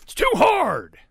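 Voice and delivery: high, booming complaint; shout. A narrator's reading. Papa pig warns that his chair is hard, shouting. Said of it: "shouted Papa Sniff".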